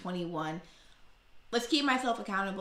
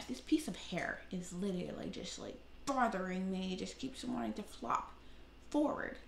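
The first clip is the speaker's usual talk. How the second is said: quiet aside; 9 dB quieter than usual. Real, not acted. Quiet aside about her hair.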